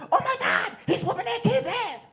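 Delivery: high, shouted crowd panic; crowd yelling. Retold or imitated. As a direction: yell it like shocked onlookers. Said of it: "Everyone's yelling".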